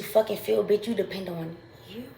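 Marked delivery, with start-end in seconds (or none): none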